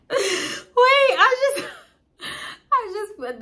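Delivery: high squealing laugh breaking through words; wheezing with real laughter; laughing helplessly. Real, not acted. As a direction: crack up mid-sentence. Cracks herself up.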